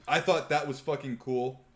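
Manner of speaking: usual speaking voice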